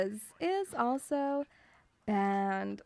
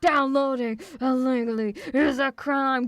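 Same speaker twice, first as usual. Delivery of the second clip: louder dramatic warning read; mock ad announcer; 8 dB louder than usual. Spoofing the anti-piracy ad to chat, loud announcer. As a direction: boom it like a trailer voice.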